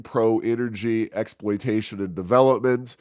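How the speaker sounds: normal talking voice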